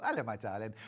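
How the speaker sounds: ordinary talk, nothing marked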